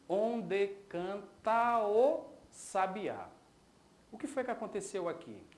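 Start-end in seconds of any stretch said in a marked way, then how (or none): 0.1-3.3 "onde canta o sabiá" very slow, each poetic syllable separated; escansão do verso. Shown as a demonstration. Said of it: "Eu tenho aqui". ("onde canta o sabiá" bem devagar, sílaba por sílaba)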